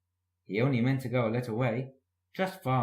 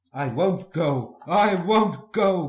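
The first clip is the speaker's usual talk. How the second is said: slow, louder, rising stubborn refusal; grumbling refusal; 6 semitones higher, 8 dB louder, slower than usual. A narrator's reading. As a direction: dig in like a sulking engine. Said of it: "grumbled Gordon".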